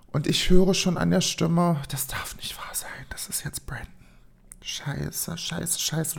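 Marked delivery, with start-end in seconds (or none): 1.9-6.2 whispered dread to yourself; entsetztes Flüstern. Retold or imitated. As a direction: whisper it as a panicked aside. Dismayed inner thought on hearing him. (erschrocken vor sich hin geflüstert)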